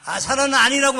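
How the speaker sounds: high, loud protest of innocence; acted flustered denial. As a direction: imitate someone hotly denying it. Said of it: "막 발뺌합니다".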